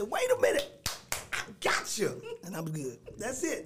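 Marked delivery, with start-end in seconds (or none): 0.0-2.2 excited. Acted. Teacher getting carried away at the pulpit, excited shout. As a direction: burst out excitedly as a worked-up teacher. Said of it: "I might get a little excited".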